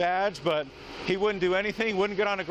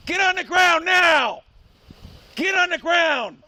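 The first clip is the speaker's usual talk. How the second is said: loud, slow barked police commands; shouted surrender demands; 7 semitones higher, 7 dB louder, slower than usual. Real, not acted. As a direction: yell it at a suspect. Police arrest at gunpoint.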